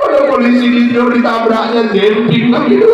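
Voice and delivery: high, loud mock lament; hammed-up wailing tale. Widow wailing to mourners how her husband died. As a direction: wail the whole story like a grieving widow. Widow wails the accident story.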